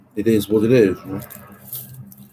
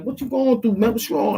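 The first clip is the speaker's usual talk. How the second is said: much higher imitated voice; imitating Oscar; 14 semitones higher than usual. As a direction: jump way up in pitch to mimic the older coworker asking. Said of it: "he was like".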